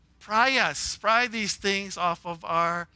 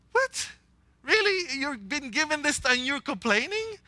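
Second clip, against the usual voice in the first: incredulous high-pitched outburst; can't believe they're complaining; surprised; 8 semitones higher than usual. Acted. Preacher outraged at complaining.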